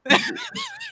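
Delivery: high-pitched giggling; laughter. Real, not acted. Laughing at her old panicked calls, high giggle. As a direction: laugh at your own impression, high and light. Laughs at own freak-out impression.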